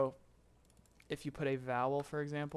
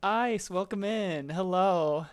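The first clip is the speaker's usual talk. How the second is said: higher, louder and slowed, a sing-song welcome; excited greeting; 5 semitones higher, 10 dB louder, slower than usual. Real, not acted. Streamer welcoming Ice into chat, loud high sing-song greeting. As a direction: call it out bright and drawn out to a new arrival.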